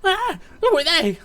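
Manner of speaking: high, loud startled cry; alarmed. Acted. Captive seeing the jailers arrive, alarmed. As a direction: yelp the question in panic.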